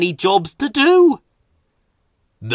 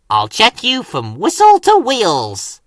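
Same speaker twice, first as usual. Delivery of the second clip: eager engine voice; 6 semitones higher, 3 dB louder than usual. A narrator's reading. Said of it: "Thomas replied".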